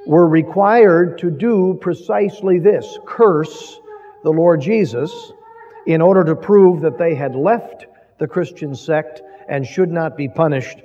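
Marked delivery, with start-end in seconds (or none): none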